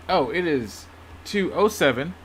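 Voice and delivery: ordinary talk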